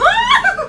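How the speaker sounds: very high, loud startled whoop; startled shriek. Real, not acted. Bored player caught by a jump scare, high loud shriek.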